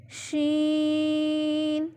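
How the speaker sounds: شین with an unforced sh, held very long; soft sheen. Shown as a demonstration. Teacher warning against hardness, soft long شین. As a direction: stretch شین evenly without hardening it. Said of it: "شین کو سخت نہیں کرنا".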